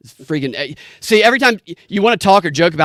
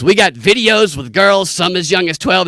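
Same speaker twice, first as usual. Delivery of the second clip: sleazy pawn shop guy; 6 semitones higher, 4 dB louder than usual. Retold or imitated. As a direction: ham up a creepy salesman, pitched up. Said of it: "And he goes".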